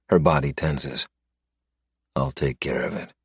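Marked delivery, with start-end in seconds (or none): 2.2-3.1 soft, grim promise; softer, steady vow to his lover; low quiet vow. Acted. Quiet vow to kill stepfather.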